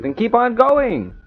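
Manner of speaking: high, drawn-out exasperated demand; mock exasperation. Host pushes friend to finish the story, mock exasperated. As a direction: stretch it out high, hammed-up impatience.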